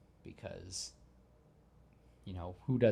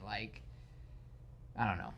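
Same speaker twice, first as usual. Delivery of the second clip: ordinary talk, nothing marked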